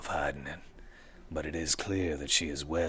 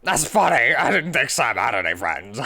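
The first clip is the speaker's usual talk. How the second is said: higher, louder amused woman; laughing Jessica; 9 semitones higher, 12 dB louder than usual. Acted. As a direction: laugh through the words.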